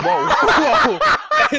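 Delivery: very high, squealing laughter through whoa; laughing whoa. Retold or imitated. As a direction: recoil laughing at the cat. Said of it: "I was like".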